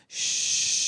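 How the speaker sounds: steady unvoiced whoosh; whooshing blood flow. Shown as a demonstration. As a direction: imitate blood rushing in the womb. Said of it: "it sounds like this".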